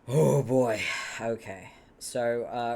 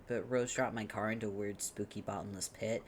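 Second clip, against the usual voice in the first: ordinary talk, nothing marked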